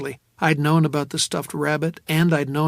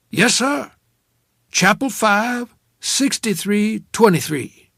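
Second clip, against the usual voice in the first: high maid slowly giving a number; 7 semitones higher, 6 dB louder, slower than usual. A narrator's reading. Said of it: "a maid, probably".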